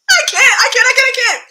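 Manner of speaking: high, loud, helpless repetition; losing it after a laughing fit; overcome with amusement. Real, not acted. Overcome after her own impression.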